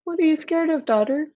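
lightly voiced father, concerned; a hint of a worried king; a hint of a gentle king. A narrator's reading. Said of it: "He says".

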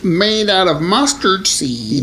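high, slow comic character; put-on character voice. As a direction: pitch it up and dispense a folk remedy slowly. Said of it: "what voice was that".

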